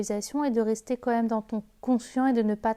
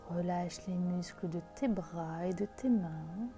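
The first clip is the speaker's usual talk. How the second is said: slow, soft meditation voice; guided meditation; 2 semitones lower, 11 dB quieter than usual. Acted. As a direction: guide them into calm, gently. Leads a guided visualisation.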